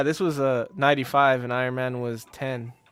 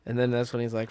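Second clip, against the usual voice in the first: ordinary talk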